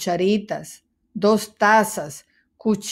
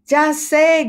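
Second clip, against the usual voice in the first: high, loud eureka shout; shouted idea; 7 semitones higher, 5 dB louder than usual. A narrator's reading. Dog shouting his idea to the mouse, high and loud. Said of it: "gritó Perro Pablo".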